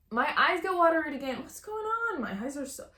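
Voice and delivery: high-pitched dismay. Real, not acted. Sewist puzzled by her watery eyes, high-pitched dismay.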